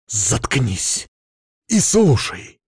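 venomous order to shut up and listen; hateful command. A narrator's reading. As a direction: silence her with open hatred. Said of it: "От ненависти, прозвучавшей в моём голосе".

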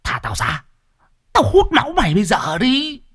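high, snarling possessed woman; a ghost threatening through the mother's mouth; possessed mother threatening the neighbors; possessed hiss. Acted. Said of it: "rít lên".

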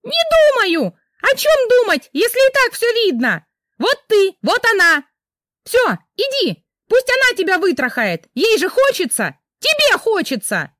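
piercing high shriek; wife shrieking at husband like a siren. Acted. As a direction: wail like a fire siren. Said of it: "верещала Рита, как пожарная сирена".

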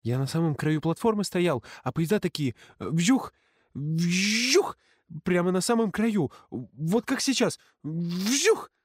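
put-on high excited kid with whooshes; excited boaster. Chubby boy showing off, high excited whooshing. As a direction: whoosh the trains eagerly. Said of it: "вертелся пухлый хвостун".

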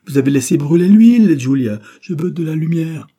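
high, loud scolding; angry shout. Acted. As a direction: blame her furiously. Dying man scolds Julia.

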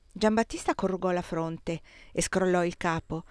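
plain reading voice

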